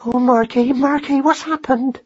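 high, slow anxious wail; nervous. A narrator's reading. Frightened traveller asking her husband what happened, high and nervous. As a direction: fret in a high, drawn-out voice. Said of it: "conversed in nervous whispers".

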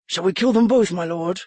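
ordinary delivery, nothing marked

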